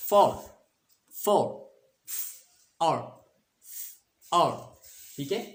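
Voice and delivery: loud "फॉर" cut down to "और"; short o demo. Shown as a demonstration. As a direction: strip the "फ" from "फॉर" to leave "और". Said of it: "औ का अ". ("फॉर" से "और" अलग करके बोलें)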